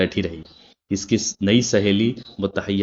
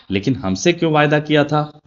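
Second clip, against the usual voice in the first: higher-pitched angry complaint; annoyed; 8 semitones higher, 3 dB louder than usual. A narrator's reading. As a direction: snap at her brother, pitched high. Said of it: "वो बिगड़ कर बोली". (बिगड़ कर ऊँची आवाज़ में)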